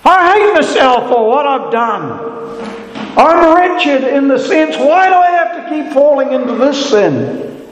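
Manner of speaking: high, anguished inner cry; desperate confession. Acted. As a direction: cry it out like a broken believer. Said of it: "cried something like that in your own words".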